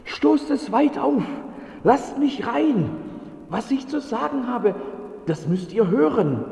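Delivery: hoch und laut, drängend außer Atem; atemloser Bote. Acted. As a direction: rein will er, sofort, mit letzter Luft. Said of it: "noch völlig außer Atem". (Bote ruft atemlos am Tor)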